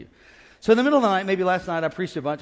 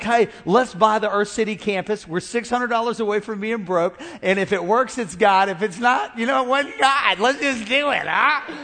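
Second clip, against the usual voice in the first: brash, louder take-it-on-faith voice; bold risk-taker; 4 semitones higher, 5 dB louder than usual. Retold or imitated. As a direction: hype them into buying, loud and sure. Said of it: "I'm the guy who's like".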